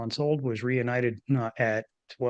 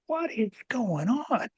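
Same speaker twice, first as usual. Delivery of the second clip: slow, much higher baffled question; bewildered; 10 semitones higher, slower than usual. Retold or imitated. Panicking through a tapping session, slow high baffled plea. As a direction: ask it as if the world tilted. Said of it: "and I'm like".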